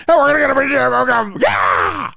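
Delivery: hammed-up candidate whooping at a rally; Howard Dean scream. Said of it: "He sounded like a bad version of".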